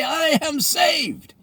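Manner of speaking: slow, high, shouted proclamation of salvation; joyous shout. Pretend. Churchgoer crying out to the sky, mock rapturous shout. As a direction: cry it out to the sky like a revival convert. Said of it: "look up in the sky and say".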